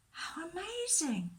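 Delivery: high, drawn-out wonder; slow, high note of awe; amazed. A narrator's reading. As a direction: marvel at it, voice lifted. Storyteller marvels at the miracle.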